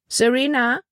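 high, rising call of a name; questioning call. A narrator's reading. Melanie turns round to the girl, questioning high call.

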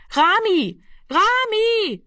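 high, long call into the dark. A narrator's reading. Annegret searches the dark street for Rami, calling out high. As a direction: keep calling for someone lost. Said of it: "rief sie fortgesetzt in die Dunkelheit".

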